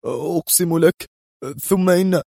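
high, frightened pleading; trembling. A narrator's reading. Henchman swears to his leader he didn't know, trembling. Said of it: "وهو يردد مرتجفا".

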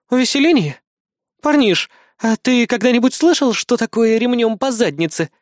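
high-pitched, outraged question; high indignant scolding; indignant woman. A narrator's reading. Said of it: "возмутилась Кри".

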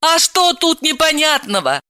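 high-pitched indignant retort; infuriated. Acted. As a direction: throw the question back, exasperated. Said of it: "разъярилась Далила".

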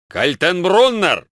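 loud drawn-out name, then a sharp high order; shouted army command "Смирно!"; barked command. A narrator's reading. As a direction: bark it like a drill sergeant. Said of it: "скомандовал Штирлиц".

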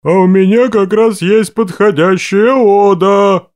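slow loud bass voice; booming bass. A narrator's reading. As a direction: declaim in a big bass. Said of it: "донеслось басовито из коробки". (басовитый голос из коробки)

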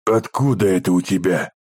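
husky, hoarse question. A narrator's reading. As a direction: ask with a hoarse throat. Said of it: "осипшим голосом".

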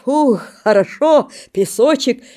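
кряхтя; grunting in icy water. Acted. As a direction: groan it out in the freezing river. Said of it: "кряхтит".

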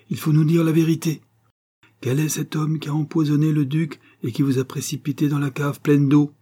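high, forceful demand; insistent interrogation. A narrator's reading. Countess demanding the poisoner's name, insistent. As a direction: press hard for the truth.

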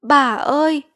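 high, loud wail for grandmother; bursting into tears. A narrator's reading. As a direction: cry out as if breaking down, acted. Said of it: "cô vừa òa lên khóc". (òa khóc gọi bà, giọng cao)

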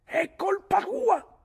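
very high, loud, drawn-out yell; insistent accusation. A narrator's reading. Mick repeats the blame over Ulick's warning, shrieking. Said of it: "ripete Mick".

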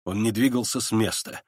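ordinary delivery, nothing marked

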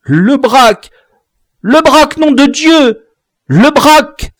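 high, loud desperate yelling of a name; boy shrieking for his captured general; shrill squawking cry. Acted. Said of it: "piailla-t-il".

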